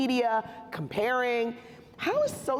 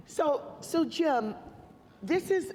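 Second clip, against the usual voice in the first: usual speaking voice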